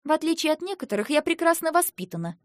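snapping. A narrator's reading. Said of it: "рявкнула я".

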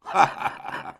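dry, deliberate staccato laugh; forced jerky laugh. A narrator's reading. Pierre laughs at his mother, forced jerky laugh. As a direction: force out a short choppy laugh. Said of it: "d'un rire voulu et saccadé".

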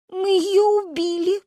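high, slow horrified cry; frightened. A narrator's reading. Girl thinks they killed Manya, frightened. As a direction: gasp it out in drawn-out horror. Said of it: "испугалась Каринка".

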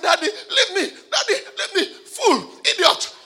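high, loud, laughing mock insults; hammed-up possessed daughter; possessed girl. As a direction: play the girl snapping at her pastor. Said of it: "She said".

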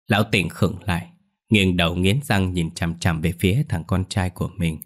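plain reading voice

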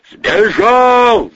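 high, loud alarm cry; yelling. Acted. Men shout news of an escape, yelling in alarm. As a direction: yell it down a corridor. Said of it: "завопили из коридора".

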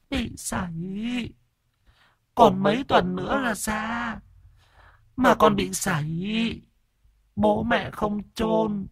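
very high, slow, faint ghost voice; tiny, thin voice of a dead infant's ghost; whimpering ghost child. A narrator's reading. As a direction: whimper it out like a lost child spirit. Ghost child answers the exorcist.

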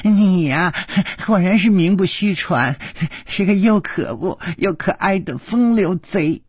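high, giggly flirting; giggling. Acted. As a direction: tease him through a giggle. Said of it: "咯咯地笑着说".

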